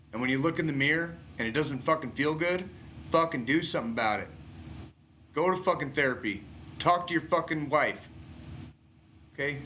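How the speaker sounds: fired-up pep talk to struggling vets, rising to a peak; rising impassioned rant. Real, not acted. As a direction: pour it out, climbing in urgency.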